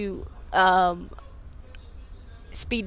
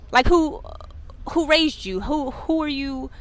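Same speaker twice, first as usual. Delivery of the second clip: ordinary talk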